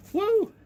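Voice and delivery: high loud woo from burnt fingers; sharp yelp of pain. Real, not acted. Grabs hot fish.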